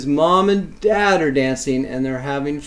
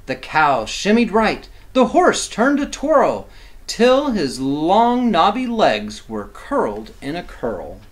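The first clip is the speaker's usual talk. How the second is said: lively, sing-song picture-book reading; reading to children; 2 semitones higher, 5 dB louder than usual. A narrator's reading. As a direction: read it like bedtime rhyme for kids.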